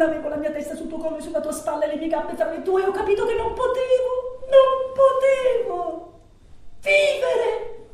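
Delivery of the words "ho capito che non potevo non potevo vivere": high, rising cry of despair; anguished confession. Acted. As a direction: drag out the despair, voice pitched high. Confesses despair after being left. (angoscia disperata, voce acuta)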